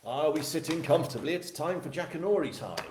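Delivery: put-on high, loud children's-programme opening; storytime announcer. Host opens the reading like children's television, storytime announcer. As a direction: playfully call the audience to storytime.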